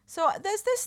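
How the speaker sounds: slipping into a posh accent; suddenly posh. Real, not acted. Co-host explains a new discovery, in an accidentally posh accent. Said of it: "why I'm suddenly going quite posh again".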